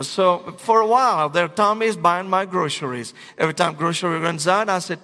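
usual speaking voice

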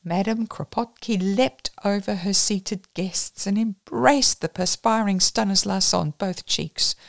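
usual speaking voice

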